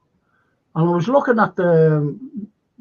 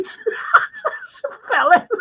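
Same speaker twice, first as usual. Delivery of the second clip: much higher, slow, cracking up; laughing; much slower than usual. Retold or imitated. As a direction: squeal it through helpless laughter. Cracking up at the reveal.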